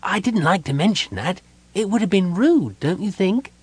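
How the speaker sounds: high-pitched, chatty little voice; kender character voice. A narrator's reading. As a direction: explain it innocently in a high voice. Narrator voices Tass, the kender.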